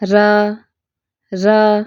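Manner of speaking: را drawn out long and slow; slow elongated را; lengthened ra. Shown as a demonstration. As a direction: stretch the را a little as a model. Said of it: "را کو تھوڑا سا لمبا کر کے پڑھتے ہیں".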